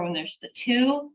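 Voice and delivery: usual speaking voice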